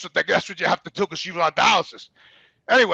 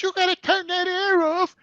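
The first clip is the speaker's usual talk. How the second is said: put-on old lady voice; 10 semitones higher, slower than usual.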